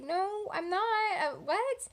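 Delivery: high, slow, stunned denial; shocked disbelief. Retold or imitated. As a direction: drag it out in stunned confusion. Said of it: "I was just kind of in shock".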